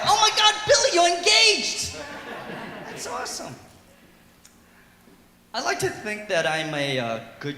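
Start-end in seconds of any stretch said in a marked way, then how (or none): 0.1-3.6 much higher, loud squeal; gushing friends. Retold or imitated. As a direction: bro gushing over the TV. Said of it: "they'll be all like".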